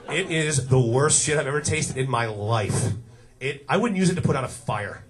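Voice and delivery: ordinary talk, nothing marked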